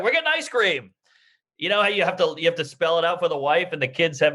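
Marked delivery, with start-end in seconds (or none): 0.0-0.9 high bright reassuring fib; cheerfully lie to the kid; fake cheerful parent.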